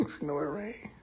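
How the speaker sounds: whispered through a laugh; mock it in a breathy whisper; amused whisper. Real, not acted.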